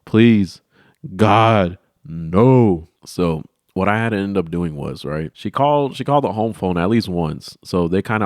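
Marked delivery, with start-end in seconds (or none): 0.0-2.9 louder desperate please; hammed-up plea; begging. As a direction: beg with everything. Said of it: "I'm over here".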